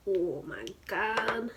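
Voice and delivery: ordinary talk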